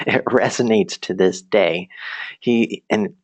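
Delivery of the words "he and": normal talking voice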